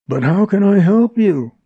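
high, loud appeal; helpless protest. Acted. Montgomery, bound himself, appeals to Jerry, helplessly. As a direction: plead that your hands are tied.

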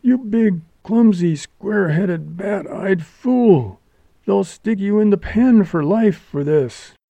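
high-pitched, furious tirade; pained outrage in a high voice. A narrator's reading. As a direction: hurl each insult, drawing out the last.

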